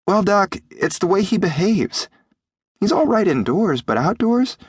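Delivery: put-on high-pitched boy explaining earnestly; high young voice, troubled; worried boy. A narrator's reading. Boy Jim explains his worry.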